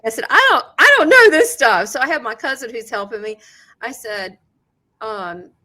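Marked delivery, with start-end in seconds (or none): 0.3-1.9 raised voice, high and insistent; retold protest, called out over noise; loud protest. Medium to scientist in a loud hall, protesting loudly.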